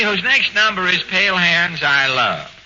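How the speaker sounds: ordinary talk, nothing marked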